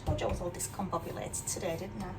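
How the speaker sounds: ordinary delivery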